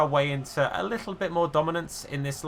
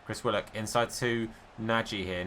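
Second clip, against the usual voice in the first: normal talking voice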